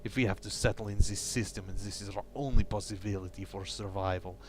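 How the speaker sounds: a lightly done fake Eastern European accent reading the diary; bad Russian accent. Gamer reading crew member Pavel's diary entries aloud, in a light fake Russian accent. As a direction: read the log in a hammy Russian accent, only a hint of it. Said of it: "my bad, fake, slightly racist Russian slash Eastern European accent".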